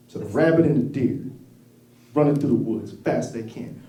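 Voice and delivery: ordinary delivery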